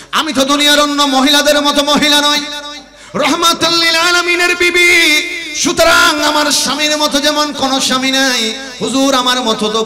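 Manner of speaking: high, loud chanted protest; ringing intoned sermon cry, easing down at the end; impassioned preaching. Acted. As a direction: proclaim the wife's plea at full force. Preacher voices wife's proud protest.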